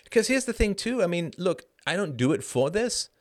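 ordinary delivery

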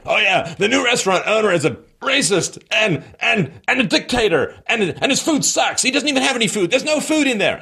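high hammed-up rant; mock ranting owner. Bitter restaurant owner ranting at rival's customers, high mock rant. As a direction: ham up a bitter shopkeeper yelling at passers-by. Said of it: "he's just screaming".

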